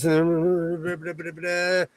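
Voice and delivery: very slow stretched Southern drawl; lazy stretched Southern drawl; slow heavy drawl. Shown as a demonstration. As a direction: drag every word out lazily. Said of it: "talking like this".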